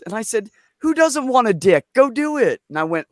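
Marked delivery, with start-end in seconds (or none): 0.8-2.6 high, loud cheering on; gleeful go for it; blunt enthusiastic parent. Retold or imitated. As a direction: egg the kid on with gusto.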